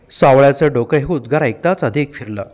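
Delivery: ordinary delivery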